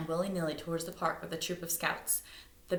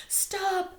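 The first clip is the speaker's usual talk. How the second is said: high sharp shout; 4 dB louder than usual. A narrator's reading. Duff shouting at Miss Flynn, high call. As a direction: stop the runner. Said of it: "shouted Duff".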